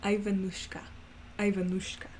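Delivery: put-on careful practice of a light Russian accent; attempted Russian accent. Trying to put the character together, tentative Russian accent. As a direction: test the name in a Russian accent. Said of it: "I have to try and put it together".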